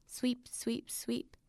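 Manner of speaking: whispered sweep sweep under breath; whispered muttering. Acted.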